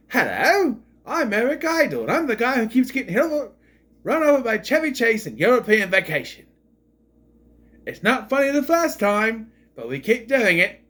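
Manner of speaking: high, loud, mock-British comedian voice; Eric Idle. Reviewer spoofing an actor introducing himself, high loud impression. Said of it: "talking like this. 'Cause you hear Eric Idle".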